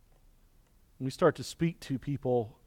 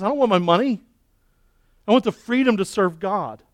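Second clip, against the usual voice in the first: raised, higher and louder declaration; Job's plea; 6 semitones higher, 7 dB louder than usual. Acted. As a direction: cry it out as Job, insisting. Preacher voices Job's plea.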